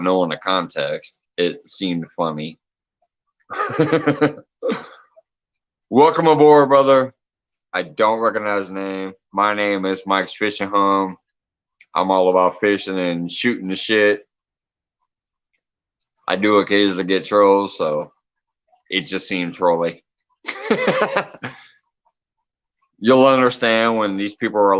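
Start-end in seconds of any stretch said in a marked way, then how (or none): 3.5-4.3 high, quick run of laughter; real high-pitched chuckling; amused laugh. Real, not acted. Amused by a trolly-looking comment. 5.9-7.1 loud, high-pitched hearty greeting; raised, emphatic greeting; hearty welcome. Real, not acted. Greeting the new viewer after apologizing. 20.5-21.5 high burst of giggly laughter; real high-pitched laugh; amused laugh. Real, not acted. Amused at seeming troll.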